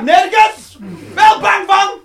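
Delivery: high, loud mocking yell; comic shout. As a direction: shout it out absurdly. (luid, hoog komisch geroepen)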